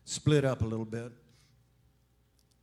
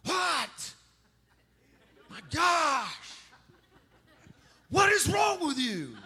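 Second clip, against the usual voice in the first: much higher, laughing outrage; grumpy woken sleeper; 12 semitones higher, slower than usual. Retold or imitated. Napper snapping at the waker, high mock outrage. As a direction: squawk like someone rudely woken.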